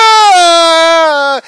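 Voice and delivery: high loud yell of disgust; disgusted scream. Retold or imitated. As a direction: scream in revulsion. Fishing it out, disgusted.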